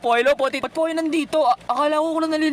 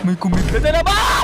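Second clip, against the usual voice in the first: startled shout; 4 dB louder than usual. Real, not acted. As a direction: yell out at a sudden scare. Jump scare in game. (gulat na sigaw na pataas)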